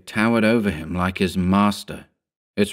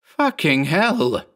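slow, higher-pitched exclamation; exasperated yell without much volume; yelled curse; 8 semitones higher, much slower than usual. A narrator's reading. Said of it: "he yelled".

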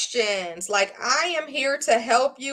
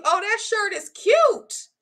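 higher, slow gushing praise; admiring compliment; 8 semitones higher than usual. Retold or imitated. A fan complimenting her shirt, higher and slower. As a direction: coo over the shirt like a fan. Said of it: "So people tell you".

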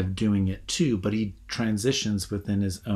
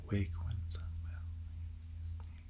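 whisper; 15 dB quieter than usual. Shown as a demonstration. Teacher quietly checking the line's syllables, in a whisper. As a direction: Wake when some vile thing is near, whispered without voice. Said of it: "just making sure".

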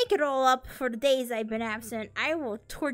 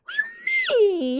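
offended cry; 10 dB louder, faster than usual. Real, not acted. Offended by "green not creative.

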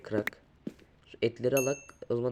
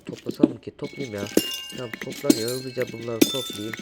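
usual speaking voice

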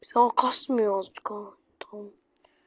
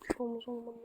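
whispering to himself; 18 dB quieter, faster than usual. Real, not acted. Gamer calming himself over the job, whispered. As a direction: whisper it to yourself under your breath. Reassuring himself under breath.

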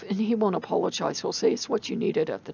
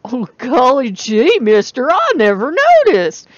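exaggerated country accent; 5 semitones higher, 11 dB louder than usual. Country girl answering teasing townsfolk, hammed-up country accent. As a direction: play up a golly-gee country accent, big and loud. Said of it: "we don't talk like that out here".

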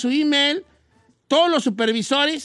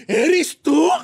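high, loud mock startled question; mock shocked father; 5 semitones higher, 6 dB louder than usual. The father realising the girl was his daughter, mock shock. Man's shocked reaction in story.

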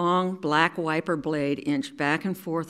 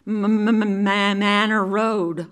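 stuttering through chattering teeth; slow, teeth-chattering mumble from cold; 4 semitones higher, 5 dB louder, much slower than usual. Retold or imitated. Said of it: "I mumbled through chattering teeth".

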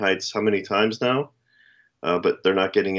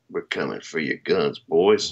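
low, menacing taunt to gun owners; gruff mock government voice; 5 semitones lower than usual. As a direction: growl it like a smug official. Mock gun-grabber threat.

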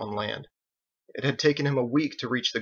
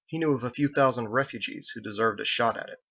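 plain reading voice

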